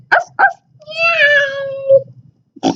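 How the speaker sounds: high, slow woof and meow; barking and meowing. A narrator's reading. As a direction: stretch out a playful bark and meow. Animals bark and meow.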